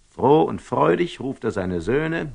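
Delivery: ordinary delivery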